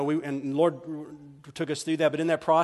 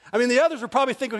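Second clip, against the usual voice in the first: usual speaking voice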